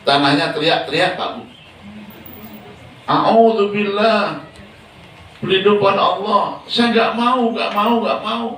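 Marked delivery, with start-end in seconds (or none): 3.0-8.5 high, pleading cry of refusal; pleading earth. Acted. Earth begging the angel not to take it, high pleading.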